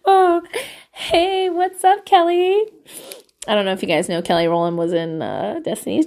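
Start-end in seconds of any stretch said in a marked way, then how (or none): 0.1-2.6 high, sing-out pretend hello; playful mock greeting. Vlogger pretending to answer [name]'s call, playfully chummy. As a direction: greet a star like an old pal, higher.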